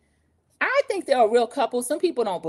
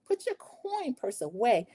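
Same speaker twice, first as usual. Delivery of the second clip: soft, slow, disgusted snooty put-down; lightly voiced prim disdain, drawn out; Whitley Gilbert; 3 semitones higher, 5 dB quieter, slower than usual. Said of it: "She sounded just like Whitley Gilbert".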